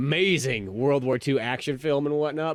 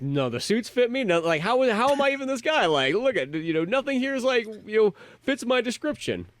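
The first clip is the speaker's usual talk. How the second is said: higher-pitched, baffled protest; 8 semitones higher than usual. Retold or imitated. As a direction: act out a mistaken man's disbelief, voice pitched up. Acting out baffled mistaken man in film.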